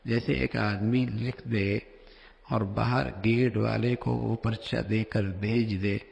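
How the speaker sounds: ordinary delivery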